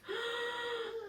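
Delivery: high startled intake of breath; sharp gasp. Acted. Parent gasping for the child at the wolf's plan. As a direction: gasp as if shocked.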